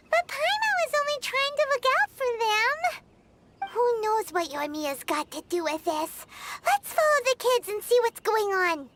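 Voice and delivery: ordinary talk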